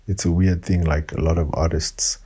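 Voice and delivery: ordinary delivery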